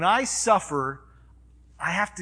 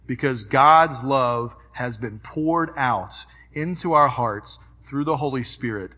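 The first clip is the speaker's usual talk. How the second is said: normal talking voice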